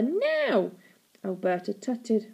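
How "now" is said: insistent high cry; impatient child's order; demanding. A narrator's reading. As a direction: demand it at once, pitch swooping up. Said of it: "demanded Stella".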